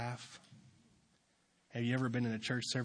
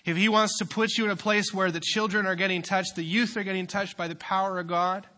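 loud, high, driving sermon voice; full-force preaching; 7 semitones higher, 8 dB louder than usual. Acted. Youth pastor preaching hard to the congregation, loud and driving. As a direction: build the sermon up with fire.

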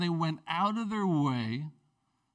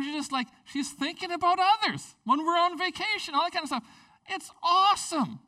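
much higher, bubbling admiration; enthusiastic praise; 12 semitones higher, 4 dB louder than usual. Real, not acted. Husband praising his wife to the church, enthusiastic. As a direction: gush about her.

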